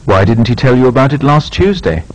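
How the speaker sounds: "Why didn't you come last week?" rising steadily, short fall on "week"; a frank, polite question that climbs and dips at the end; "Why didn't you come last week?" with a continuous rise and a final fall; rising tune, short final fall. Shown as a demonstration. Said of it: "Make similar questions using why or how".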